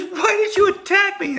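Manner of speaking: high, loud wounded mom voice; hurt mom. Retold or imitated. Mom confronting her son, high hurt voice. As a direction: demand an answer, hurt. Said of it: "She goes".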